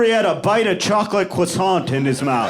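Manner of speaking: ordinary talk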